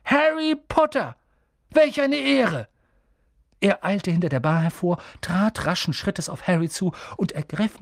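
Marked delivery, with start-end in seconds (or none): 0.0-2.7 put-on much higher and louder, an old man overwhelmed with honour; ergriffener alter Barmann. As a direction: cry it out like meeting a legend. Barmann begrüßt Harry ergriffen. (alter Barmann, hoch und laut vor Ergriffenheit)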